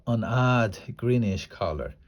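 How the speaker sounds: ordinary delivery, nothing marked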